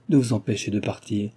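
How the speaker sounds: usual speaking voice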